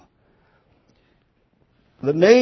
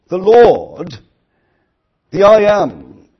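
ringing declaration; 5 semitones higher, 10 dB louder, slower than usual. Acted. Preacher voicing David's declaration.

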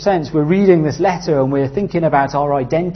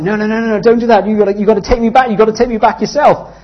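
high, loud frantic pleading; panicked surfer refusing the board, pleading; retold panic; 8 semitones higher, 7 dB louder, faster than usual. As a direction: beg in a panic to be taken back. Panicked reply while drowning.

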